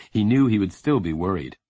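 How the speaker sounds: ordinary delivery, nothing marked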